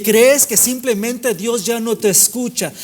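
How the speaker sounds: driving, high-energy sermon delivery; impassioned preaching. Real, not acted. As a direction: preach it in insistent, rolling repetitions. Preaches at full force.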